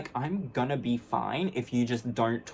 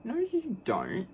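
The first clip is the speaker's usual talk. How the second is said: high, slow mocking no; sing-song dismissive no; flat rejection; 10 semitones higher, slower than usual. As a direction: shut him down with scorn. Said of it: "And I'm like".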